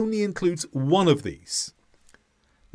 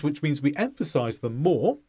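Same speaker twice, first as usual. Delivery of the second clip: ordinary talk, nothing marked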